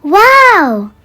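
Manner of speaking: amazed wow. Acted. Henry sees transformed campsite.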